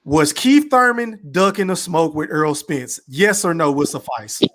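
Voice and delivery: ordinary delivery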